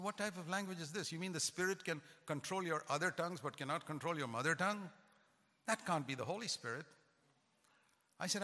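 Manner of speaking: ordinary talk, nothing marked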